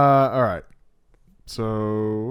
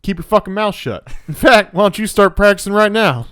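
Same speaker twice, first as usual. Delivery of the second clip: Aldo Raine impression; 8 semitones higher, 9 dB louder than usual. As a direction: bark it like a cocky Southern officer. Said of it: "He's like".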